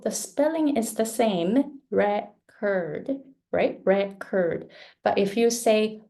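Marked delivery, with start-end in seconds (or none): 1.9-3.2 "record" as a noun, REC strong; stress on REC. Shown as a demonstration. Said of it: "Re is stronger than". 3.8-4.7 "record" as a noun, REC strong; stress on REC. Shown as a demonstration. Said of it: "Re is stronger than".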